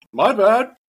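put-on ball boy shrugging it off; casual ball boy. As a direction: toss off an apology. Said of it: "He's like".

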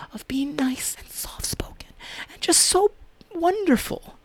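high breathy mocking whisper; mock soft whisper. Real, not acted. Mocking critics' ideal of soft-spoken niceness in a whisper. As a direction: sneer at niceness in a sweet hush.

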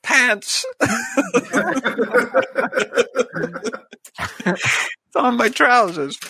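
high, laughing through a mouthful; mouth full, laughing. Pretend. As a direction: talk as if mouth is full of porridge. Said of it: "I got a mouthful of porridge here".

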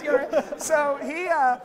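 usual speaking voice

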